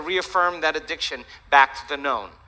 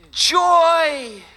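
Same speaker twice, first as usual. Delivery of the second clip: loud, high, drawn-out cry; exultant shout; 7 dB louder than usual. Acted. Golfer calling out to friends while swinging, exultant shout. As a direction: belt it out long and high on the swing.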